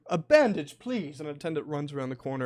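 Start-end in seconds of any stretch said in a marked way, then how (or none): 0.1-1.2 lightly raised, urgent call; calling for help. Acted. Captain sends an attendant for a bandage, calling out. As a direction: call out to an attendant, louder on the first words.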